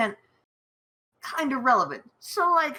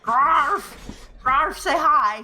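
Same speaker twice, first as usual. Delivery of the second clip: calling the dog; 6 semitones higher, 6 dB louder, much slower than usual. Acted.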